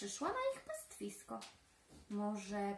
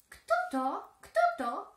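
high, loud squawky bird call; jay bird's sharp alarm call; screeching jay; 10 semitones higher, 9 dB louder, slower than usual. Acted. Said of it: "skrzyczy Sójka".

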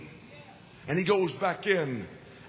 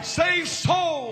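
high, ringing pulpit shout; fervent cry; 10 semitones higher than usual. Real, not acted. Preacher driving home the leaders' mission, fervent cry. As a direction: cry it out as the heart of the message.

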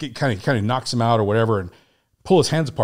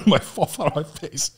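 higher, through laughter; laughing; 4 semitones higher than usual. Real, not acted. Telling how he fell flat again, laughing through it. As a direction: crack up while telling it.

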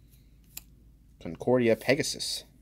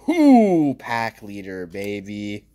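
excited whoop; 7 dB louder, much slower than usual. Real, not acted. Opening card packs, whooping at a good card. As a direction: cheer out loud at a great pull.

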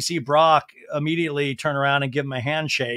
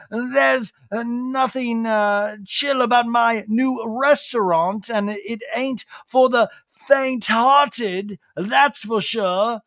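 slow, high showy promo impression; Lisa Vanderpump; 6 semitones higher, slower than usual. Lisa promoting her new restaurant, showy impression. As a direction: draw out each phrase like a grand advert. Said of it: "promotional is like".

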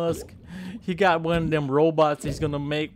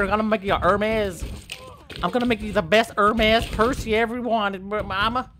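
ordinary talk, nothing marked

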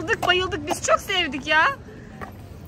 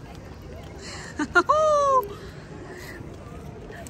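airy laugh; 7 semitones higher, 5 dB quieter than usual. Real, not acted. Laughing at smoke.

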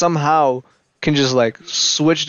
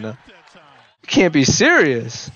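ordinary talk, nothing marked

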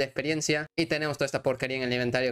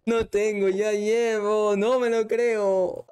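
high, drawn-out lament; dismayed wail; 8 semitones higher, 4 dB louder, much slower than usual. Real, not acted. Player can't believe what is missing, dismayed wail. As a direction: wail it slowly in raised pitch. Player finds he lacks items.

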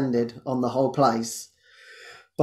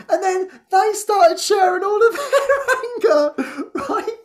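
laughing; 4 dB louder than usual. Real, not acted. Recalling the group sharing anger.

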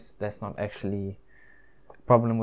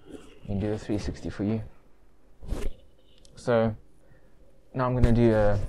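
muffled behind cloth mask; speaking under fabric covering; through a face mask. Real, not acted. Seen 2: Mask covers mouth and nose throughout; removed by 25.1.

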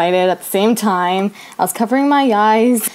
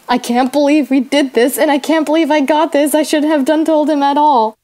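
higher-pitched, dismayed complaint; retold distraught regret; 5 semitones higher than usual. Teller to friend after being turned down, upset regret. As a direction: pitch it up, regretting the whole thing.

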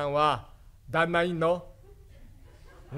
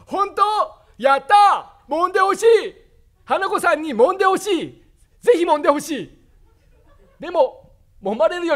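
excited grandpa; 9 semitones higher, 7 dB louder than usual. Acted. Grandpa excited about massage.

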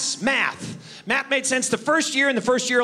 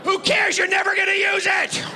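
exasperated shout; 8 semitones higher than usual. Real, not acted. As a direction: yell it like you're done with math.

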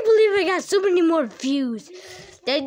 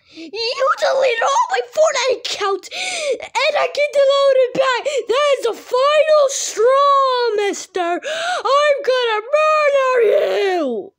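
high, loud, slow furious yell; furious threat; 8 semitones higher, 5 dB louder than usual. Acted. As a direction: scream the murder threat.

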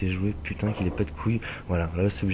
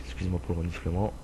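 ordinary delivery

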